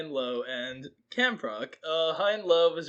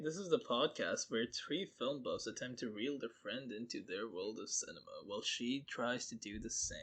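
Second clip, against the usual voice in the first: hushed mock-serious whisper; 7 semitones lower, 12 dB quieter than usual. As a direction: whisper the intro softly. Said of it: "Why are we whispering?".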